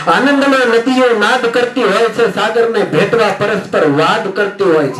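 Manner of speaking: high, rhythmic verse recital; lofty recital with a lift; poem recital. Acted. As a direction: sing the line out to the audience.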